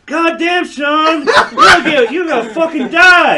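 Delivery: high, loud laughing alarm; mock alarmed yell. Southerners warning Sean in a t-shirt, loud mock alarm. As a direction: holler it in disbelief. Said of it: "they're like".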